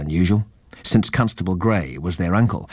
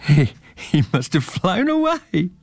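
laughing; 9 semitones higher than usual. Acted. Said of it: "laughed Joe".

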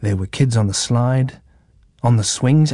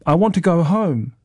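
higher, mildly tearful little plea; 6 semitones higher than usual. A narrator's reading. Jonah begs Mum to take him home, mildly tearful. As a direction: plead to leave with a touch of tears. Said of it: "Jonah's eyes started to water".

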